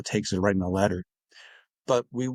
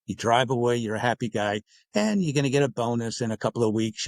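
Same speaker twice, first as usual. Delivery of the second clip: ordinary talk, nothing marked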